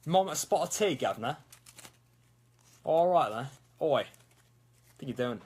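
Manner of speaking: mock British guv'nor; exaggerated British English; hammed-up British accent. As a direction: put on a cartoonish British voice. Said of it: "British stuff, that's just difficult".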